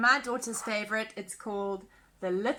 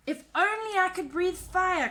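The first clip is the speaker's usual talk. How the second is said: wistful cry; 7 semitones higher than usual. Acted. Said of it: "cried the littlest dragon".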